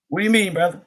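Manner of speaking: ordinary talk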